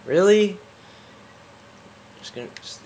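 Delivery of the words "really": loud, exasperated yelp, pitch jumping up; frustrated outburst. Real, not acted. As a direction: yell it in disbelief at the game. Gamer angry at repeated failure.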